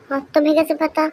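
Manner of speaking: usual speaking voice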